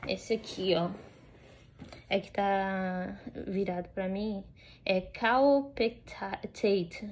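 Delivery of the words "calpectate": slow, halting sounding-out of "Calpectate"; tentative pronunciation. Real, not acted. Dog owner reading a medicine name to viewers, tentative pronunciation of "Calpectate". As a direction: sound out "Calpectate" piece by piece, unsure. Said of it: "eu acho que se fala assim".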